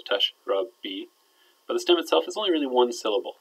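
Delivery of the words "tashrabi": "tashrabi" drawn out slowly in three separate syllables. Shown as a demonstration. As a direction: break "tashrabi" into tash, rab, bi at half speed. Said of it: "might be three syllables".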